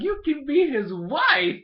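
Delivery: high, loud, stretched-out imitation; excited mock proposal. Little sister proposing a wife for her brother, hammed-up excitement. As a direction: announce it way up high, slowly.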